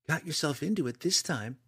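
high-pitched big-sister reproach; scolding big sister. A narrator's reading. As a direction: lecture your little brother. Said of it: "scolded in her best big sister voice".

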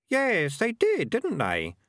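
put-on high young boy voice; kid voice. A narrator's reading.

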